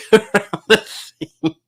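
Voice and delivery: high, slow words breaking into laughter; laughing. Real, not acted. To Matt about a quick car review, laughing. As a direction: laugh through the end of the joke. Laughing at his own silly idea.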